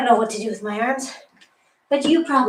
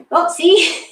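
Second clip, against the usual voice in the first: high, loud surprised yelp; 6 semitones higher, 6 dB louder than usual. Real, not acted. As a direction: caught out by her own slip. Catches own mistake.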